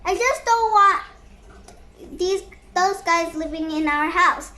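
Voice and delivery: usual speaking voice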